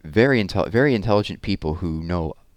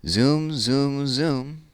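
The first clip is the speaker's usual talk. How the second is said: high, stretched-out zooms; playful rocket zoom for the kids; excited zooming; 9 semitones higher, much slower than usual. Acted. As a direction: let each zoom fly up.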